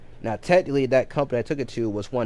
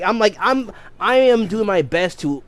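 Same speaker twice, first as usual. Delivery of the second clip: ordinary talk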